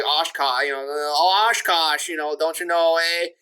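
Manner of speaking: lightly imitated thick Wisconsin accent. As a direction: do dad's Upper Midwest Wisconsin twang. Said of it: "with a thick Wisconsin accent".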